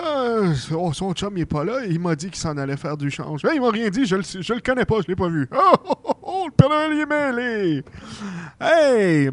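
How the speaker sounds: lightly voiced muddled old Santa; flustered Santa Claus. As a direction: mumble the excuse, then lilt into a greeting. Flustered Santa moves to next child.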